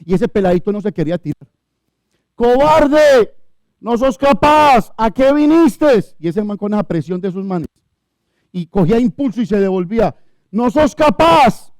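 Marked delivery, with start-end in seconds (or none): 2.3-6.0 loud, slow jeering shout; taunting yell. Retold or imitated. Boys on the bridge jeering a scared friend, loud taunting yell. 10.5-11.7 loud, slow jeering shout; taunting yell. Retold or imitated. Boys on the bridge jeering a scared friend, loud taunting yell.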